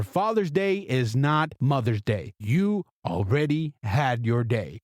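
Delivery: normal talking voice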